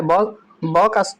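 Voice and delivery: ब said slowly and separately as a sound; demo sound ba. Shown as a demonstration. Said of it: "ब शब्द आ रहा है". (ब की ध्वनि धीरे-धीरे बोलकर दिखाना)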